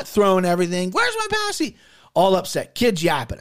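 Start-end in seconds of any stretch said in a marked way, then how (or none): 0.9-1.8 put-on very high little toddler voice. Dad imitating his one-year-old demanding milk. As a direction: pipe it up like a tiny kid. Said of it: "but she's like".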